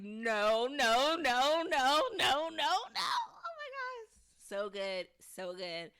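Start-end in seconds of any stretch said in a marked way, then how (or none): 0.0-2.7 rising, louder panicked string of no's. Retold or imitated. Tabitha sees the tree in the road, panicked no's. 2.7-4.1 thrilled gasp. Real, not acted. Host reacts to the episode.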